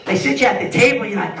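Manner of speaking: usual speaking voice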